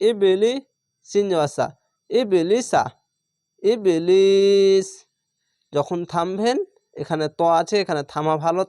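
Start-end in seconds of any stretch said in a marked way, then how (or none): 3.6-4.9 slow drawn-out recitation of ইবলিস; Quranic recitation. Shown as a demonstration. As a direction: chant ইবলিস with a long held vowel. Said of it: "এরকম পড়বে।".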